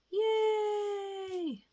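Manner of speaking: high squealed yay; excited cheer. Real, not acted. Crafter cheering at her treasure tag, high squeal. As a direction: squeal it with delight.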